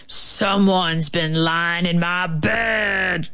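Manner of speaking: slow growling gruesome voice; long, rough bear roar; big gruesome bear. A narrator's reading. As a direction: growl it slowly like a monstrous grizzly. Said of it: "great big gruesome voice".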